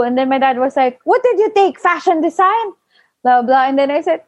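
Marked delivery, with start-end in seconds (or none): 1.0-2.7 high-pitched mock outrage; retold fatherly disbelief, pitched up; mad dad. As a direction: imitate an incredulous, angry father. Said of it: "then he will get mad".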